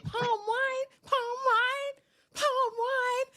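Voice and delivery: very high, drawn-out monotone; high-pitched sing-song mimicry; mock timid parroting. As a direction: squeak it out in a mocking high voice. Mimics her repeating his words.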